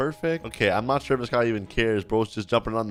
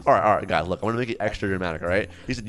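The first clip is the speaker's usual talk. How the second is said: ordinary delivery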